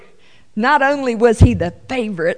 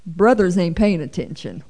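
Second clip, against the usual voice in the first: ordinary talk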